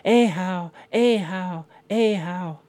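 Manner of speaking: high chanted leap sound; jumping salmon call. Acted. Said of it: "Out jumping in the bay again".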